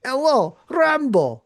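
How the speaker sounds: put-on playful over-the-top Russian accent; mock Russian accent. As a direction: ham up a Russian bad guy. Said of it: "I didn't even get to go like".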